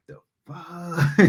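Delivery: high, startled disbelief; shocked. Retold or imitated. Jill to towel-clad Phil, startled and high. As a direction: caught off guard by the towel.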